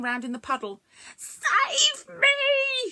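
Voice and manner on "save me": much higher, louder scream; drowning creature screaming; screaming for help. Acted. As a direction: scream from the water. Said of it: "she screamed".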